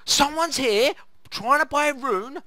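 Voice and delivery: high, slow, breathless excitement; excited squeal. Retold or imitated. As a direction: squeal like you just spotted a fortune.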